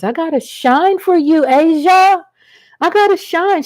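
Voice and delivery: big showy shout-out; playful hype. Real, not acted. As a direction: belt it out to a favorite viewer. Playfully hyping herself to a viewer.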